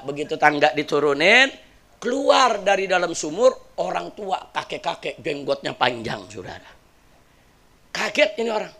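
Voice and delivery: ordinary delivery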